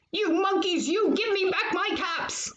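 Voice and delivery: put-on angry shout held back for children; mild storybook shout. A narrator's reading. Peddler shouting at monkeys, mild mock shout. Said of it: "and he shouted".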